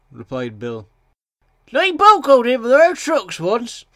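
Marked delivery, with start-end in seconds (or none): 1.7-3.8 high, loud engine character; loud, high-voiced little engine. A narrator's reading. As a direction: pipe up loudly in a high voice. Engine Bill chimes in.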